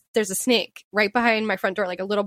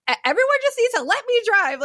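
high-pitched mock outburst; exasperated driver at the end of his rope, hammed up; imitating him losing it; 13 semitones higher, 4 dB louder than usual. Retelling the driver snapping at his backseat critics, mock exasperation. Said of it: "lost it".

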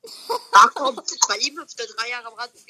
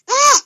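sudden high scream of alarm; panicked yelp; 13 dB louder than usual. Real, not acted. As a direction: cry out as you get hit. Shot at in game.

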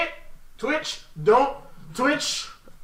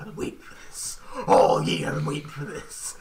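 menacing whisper; 7 semitones lower, 6 dB quieter than usual. Retold or imitated. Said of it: "he's gonna be like".

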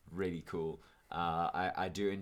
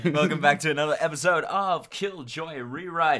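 announcer voice; 6 semitones higher, 7 dB louder than usual. Acted. Announcer welcome.

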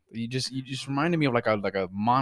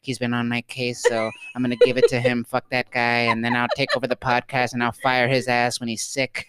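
flat, lowered mocking impression of cohost; mock monotone Jasmine; 3 semitones lower than usual. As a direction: parody her bored, scheming voice. Mocking cohost's inner monologue.

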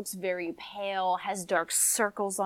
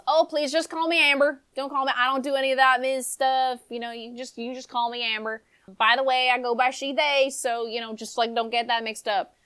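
higher, breezy casual chatter; chatty young woman; 5 semitones higher, 4 dB louder, faster than usual. Acted. Amber eyeing the family's house, higher chatty voice. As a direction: rattle on like a curious, offhand visitor.

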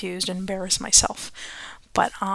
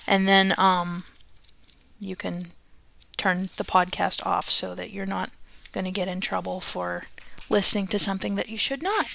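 ordinary delivery, nothing marked

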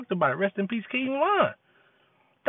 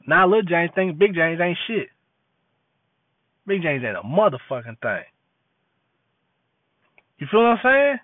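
ordinary delivery

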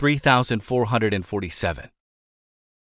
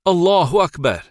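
Allahu Akbar called out higher and louder; a raised, ringing call of praise, held in reading; retold shout; 6 semitones higher, 4 dB louder than usual. Companions answering the Prophet's glad tidings, a loud cry. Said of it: "We shouted".

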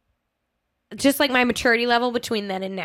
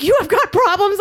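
higher, louder, fed-up comeback; raised retort; 9 semitones higher, 6 dB louder than usual. Retold or imitated. Snapping back at the screaming date, exasperated. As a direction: push back at him, exasperated.

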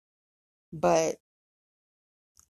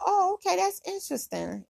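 squeaky high, impressed; mock surprise; 13 semitones higher than usual. He reacts to her job doing lip injections, high and surprised. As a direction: jump way up in pitch, pleasantly surprised.

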